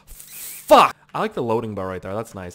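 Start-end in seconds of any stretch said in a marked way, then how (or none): 0.1-1.0 sudden high, very loud curse; frustrated shout. Real, not acted. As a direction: explode on one swear word. Curses loudly after failing.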